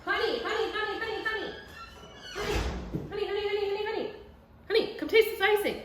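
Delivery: high singsong calling honey. Real, not acted. Wife calls her husband to taste icing, high singsong. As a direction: call him in, high and playful.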